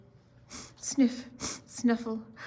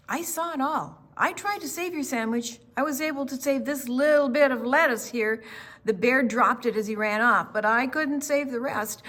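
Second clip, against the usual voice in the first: excited; 4 semitones higher, 6 dB louder than usual. Acted. Apologetic character voice in story.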